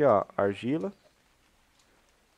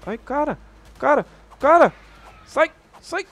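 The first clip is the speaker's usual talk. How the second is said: shrieking high and loud in panic; panicked scream; 14 semitones higher, 7 dB louder than usual. Real, not acted. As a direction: yell at it to get away. Monster attacks, panicked yelling.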